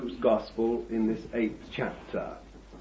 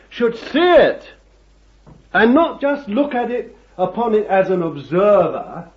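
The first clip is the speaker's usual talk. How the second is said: loud, high-pitched sermon delivery; ringing pulpit proclamation; impassioned preaching; 8 semitones higher, 8 dB louder than usual. Real, not acted. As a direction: preach it at full force, punching the key words. Preaches at full force.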